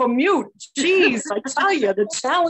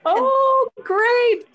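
surprised; 12 semitones higher than usual. Real, not acted. Raffle winner just announced.

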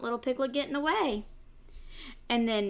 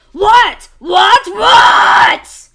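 much louder, higher repeated what; shouting fox; 11 semitones higher, 24 dB louder, much slower than usual. Acted. Fox shouts at the piglet's new excuse, very loud. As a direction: yell it in exasperation. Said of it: "shouted the fox".

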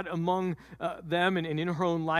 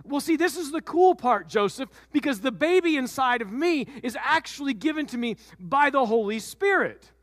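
put-on high, delighted young wife; eager Mary; 6 semitones higher than usual. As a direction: share the cool part with glee.

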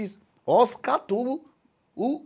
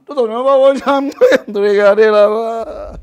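loud exasperation; 4 semitones higher, 13 dB louder, much slower than usual. Real, not acted.

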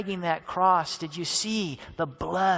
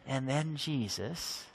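ordinary delivery

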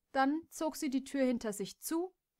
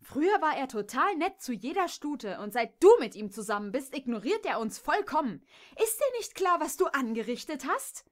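loud, higher, reproachful outburst; angry accusation; 5 semitones higher, 6 dB louder than usual. Acted. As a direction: blame her with rising fury. Kimberly accuses Jess angrily. (Kimberly wirft Jess wütend und laut vor, Clive verändert zu haben)

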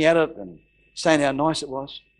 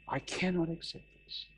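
much softer, choking up on the repeat; emotional, choked; 2 semitones higher, 12 dB quieter than usual. Real, not acted. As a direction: let the emotion drop the voice away. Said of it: "I might get a bit emotional".

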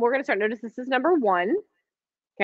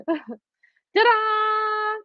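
excited reveal; 12 semitones higher than usual. Real, not acted. Showing off the finished block.

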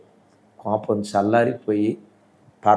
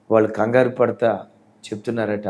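ordinary talk

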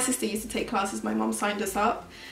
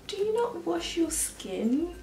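imitating a little girl, high and slow, politely asking; a sweet, innocent, curious child's question; mock polite child voice, higher; 7 semitones higher, 4 dB quieter, slower than usual. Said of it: "Like, so politely though".

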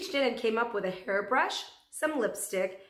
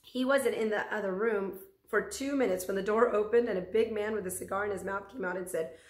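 ordinary delivery, nothing marked

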